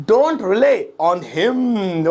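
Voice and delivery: very slow, words drawn right out; slow model repeat. Shown as a demonstration. Teacher models the English phrase for students, slow and stretched. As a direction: stretch each word, holding the final "him।".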